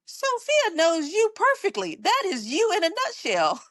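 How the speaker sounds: lightly voiced husband, delighted he's been proven right; amused husband. Husband voiced in retold story.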